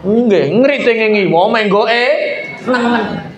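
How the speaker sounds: slow, mocking repeat in a put-on voice; mocking imitation. Man from Gresik mockingly repeating the signal complaint, slow. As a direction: drag the words out to mock the rider.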